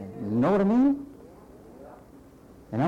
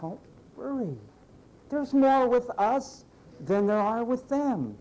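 calm reassurance; 8 semitones higher, slower than usual. Acted. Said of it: "man repeats reassurance".

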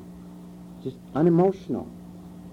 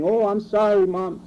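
higher, pleading mock-tearful apology; whiny crying child; 6 semitones higher than usual. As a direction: play the child whining sorry in a higher voice. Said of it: "are crying".

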